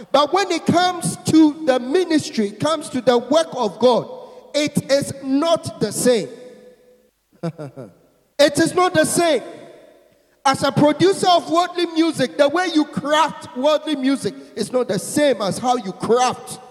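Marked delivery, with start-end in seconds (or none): 0.1-4.1 preaching shout. Real, not acted. 8.4-16.6 emphatic preaching. Real, not acted. Preacher hammering the contrast.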